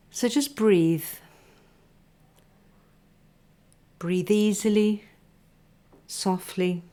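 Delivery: unhurried meditation teacher; guided body scan. Acted. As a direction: lead them gently into stillness, breath by breath.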